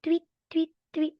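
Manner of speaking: high, short playful chirps; bird tweet. Pretend. Teacher quizzing a child on animals, bird tweet. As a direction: peep like a little bird. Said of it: "animal is that?".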